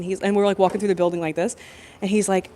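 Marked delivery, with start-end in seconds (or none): none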